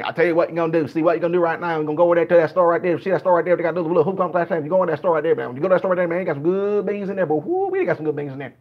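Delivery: rapid-fire Florida drawling twang; Florida Southern accent. Acted. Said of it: "that's how some of the people in Florida talk".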